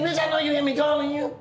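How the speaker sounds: high, laughing scold; angry scolding. Retold or imitated. Scolding the girl who ignored him, mock angry. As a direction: scold her, half laughing. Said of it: "I said".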